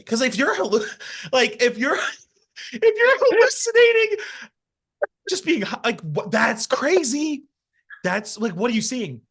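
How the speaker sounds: words squeezed out high through laughter; giggly, breaking into laughter mid-sentence; laughing. Real, not acted. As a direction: laugh your way through the line, pitch way up. Laughing at pot brownie story.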